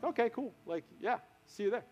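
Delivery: hushed, offhand nonchalance; playing it cool. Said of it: "Played it cool".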